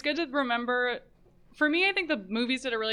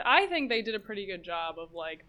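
ordinary talk

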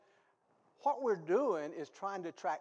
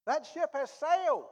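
fiery preaching; 10 semitones higher, 9 dB louder than usual. Real, not acted. Preaching at full force.

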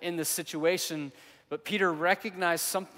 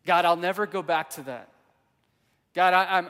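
desperate vow; 7 dB louder than usual. Retold or imitated.

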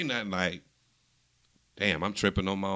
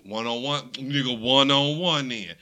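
raised-voice challenge, stretched out on the repeat; retold challenge; 4 semitones higher, 6 dB louder, slower than usual. Retelling a one on one basketball challenge to his friend, challenging. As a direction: imitate calling a friend out, building louder and slower.

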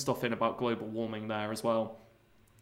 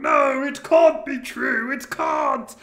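much higher, louder, slow anguished cry; hammed-up despair; 11 semitones higher, 7 dB louder, slower than usual. Bean smashing the TVs, hammed-up anguished wail. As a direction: wail it like a tragic hero.

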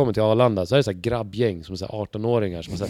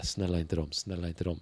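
quiet pleading; 4 semitones lower, 9 dB quieter than usual. Retold or imitated. Silently begging the lads avoid bus.

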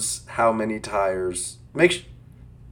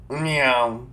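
cat meow. Pretend. Cat meow between segments.

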